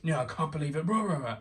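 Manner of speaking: high, loud mock cheering of a thrilled dad; imitating a dad cheering wildly. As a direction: whoop it up like an overjoyed father. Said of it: "My dad was like".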